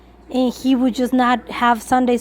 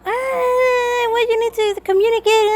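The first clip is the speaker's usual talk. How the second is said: whiny, much higher mock tantrum; mocking his tantrum; 7 semitones higher, slower than usual. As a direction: mimic him throwing a childish fit, cry-like wail. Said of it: "he made this huge fit, like, tantrum".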